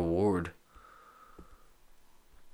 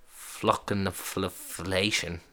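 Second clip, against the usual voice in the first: slow, stumbling, softer sounding-out; halting attempt; 7 dB quieter, much slower than usual. Real, not acted. Man trying a hard word for a pronunciation site, slow and halting. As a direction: feel your way through the long word.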